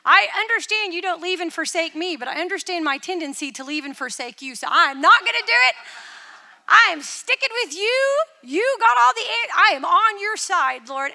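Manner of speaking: loud, high, passionate declaration; fervent vow to God. Retold or imitated. Praying through a hard year, loud fervent vow. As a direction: cry it out as a fierce promise to the Lord. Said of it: "I just thought".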